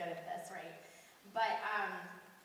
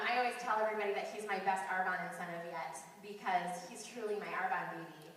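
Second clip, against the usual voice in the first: ordinary talk, nothing marked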